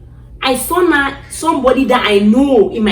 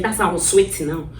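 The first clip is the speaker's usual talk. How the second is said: usual speaking voice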